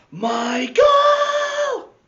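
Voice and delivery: high, loud shout of Michael; supervisor's shout. Acted. Recess supervisor shouts at Michael, high and loud. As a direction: yell across the playground. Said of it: "said the recess supervisor".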